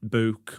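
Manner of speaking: "Book" with a short oo, like "look", in a Stoke accent. Shown as a demonstration. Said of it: "mine got quite a lot of attention".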